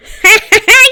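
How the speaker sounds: high, loud gleeful cackle. Acted. Spirit fleeing with Gil's clothes, gleeful cackle. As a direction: cackle as you run off. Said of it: "He cackled".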